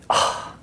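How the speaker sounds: short breathy scoffing ah; disgust noise. Shown as a demonstration. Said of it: "the disgust noise".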